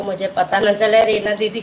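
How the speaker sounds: ordinary talk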